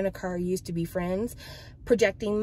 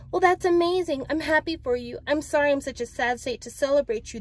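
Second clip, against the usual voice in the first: high-pitched, gushing character read; acted delighted surprise; congratulating friend; 8 semitones higher than usual. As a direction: start high and ease down as you congratulate.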